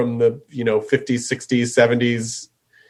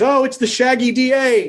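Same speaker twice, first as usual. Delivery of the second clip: higher, excited retold exclamation; mock childhood excitement; 9 semitones higher than usual. Host reliving Sunday night Disney TV, higher mock excitement. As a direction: exclaim it like a thrilled kid, pitched up.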